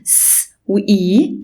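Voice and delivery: slow س وإي, hiss then long ee; slow blend. Shown as a demonstration. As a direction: build the C sound slowly. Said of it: "اللونج فاول ساوند اسمه اي".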